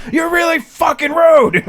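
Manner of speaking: loud high slow shout. Retold or imitated. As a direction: yell it right in her face. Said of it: "I'm like".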